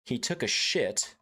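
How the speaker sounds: ordinary talk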